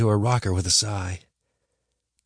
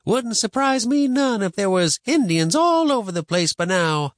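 high, louder, emphatic grandma voice; warning grandma; 12 semitones higher, 5 dB louder than usual. Acted. Grandma warning boy about Indians, emphatic. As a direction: sound the alarm in a high old-woman voice.

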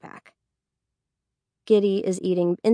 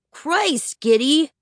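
put-on higher, exasperated teen protest; annoyed outburst; 8 semitones higher, 4 dB louder, slower than usual. A narrator's reading. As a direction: burst out at a pestering sister. Brother flings door open, annoyed.

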